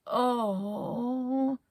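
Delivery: a groaning "oh"; the whole crew groans at having to climb. Acted. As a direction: groan it with dismay. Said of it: "Everybody groaned loudly".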